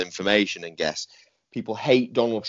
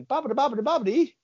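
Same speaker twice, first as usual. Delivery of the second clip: far higher squeaky mock Italian babble; fake Italian gibberish. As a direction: overdo the fake Italian, pitched way up. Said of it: "the guy's like".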